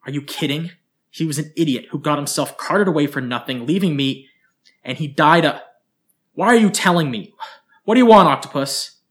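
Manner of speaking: rising to a loud, high outburst; widow's anger swelling to a shout; building fury. Acted. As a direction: start bitter and end screaming. Grieving widow erupts.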